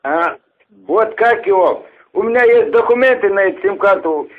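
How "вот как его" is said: ordinary delivery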